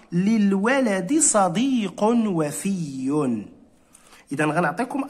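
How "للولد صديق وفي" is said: each word of للولد صديق وفي drawn out slowly; slow model sentence. Shown as a demonstration. Teacher reading example sentence to pupils, slow and clear. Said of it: "ونقول في جملة".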